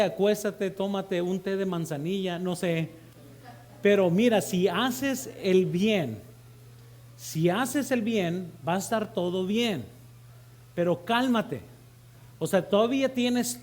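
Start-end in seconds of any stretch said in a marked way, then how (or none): 4.4-8.5 very slow, deliberate repetition; slow emphasis. Real, not acted. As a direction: lay it out word by word. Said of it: "repeats slowly to stress". (despacio y marcado, palabra por palabra)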